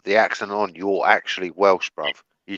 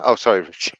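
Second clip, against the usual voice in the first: ordinary talk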